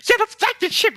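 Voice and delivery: very high, loud, squealing excitement; excited outburst. Real, not acted. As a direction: burst out as you recognise it. Excited on recognising the villain's ship.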